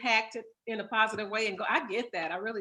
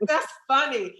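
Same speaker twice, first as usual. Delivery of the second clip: slow, louder words full of laughter; laughing; 5 semitones higher, 6 dB louder, slower than usual. Real, not acted. Interviewer cracking up at the bubbler story, laughing. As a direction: laugh it out, delighted.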